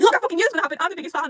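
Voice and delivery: loud, high imagined victory cry; triumphant gloating. Acted. Fan imagining being proved right, triumphant. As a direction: crow it loudly at your own past guess. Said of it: "come back to this and be like".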